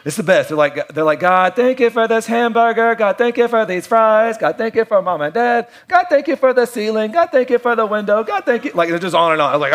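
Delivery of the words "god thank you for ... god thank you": put-on higher, eager little-kid voice rattling off thanks; imitating a kid saying grace. A kid asked to say grace at the table, higher eager imitation. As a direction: play a child listing everything he's thankful for, higher. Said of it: "a kid that's asked to say grace".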